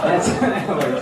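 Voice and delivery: ordinary delivery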